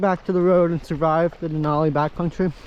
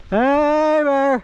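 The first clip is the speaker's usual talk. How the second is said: calling out to bears; 6 semitones higher, 4 dB louder than usual. Real, not acted. Hiking in bear country.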